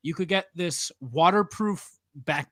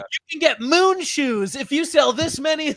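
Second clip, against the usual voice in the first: put-on kid's prize pitch; 8 semitones higher, 5 dB louder than usual. Kid sales pitch recalled.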